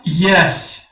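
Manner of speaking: یس sounded "yes" for learners; English pronunciation demo. Shown as a demonstration. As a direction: say یس as "yes", with a short e.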